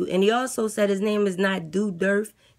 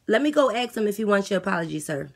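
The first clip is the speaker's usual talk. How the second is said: ordinary talk, nothing marked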